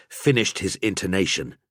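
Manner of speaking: usual speaking voice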